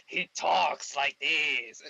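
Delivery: slow, drawn-out character voice; Coffin Joe. Shown as a demonstration. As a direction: stretch every word out, over the top. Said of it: "doing the director as Coffin Joe".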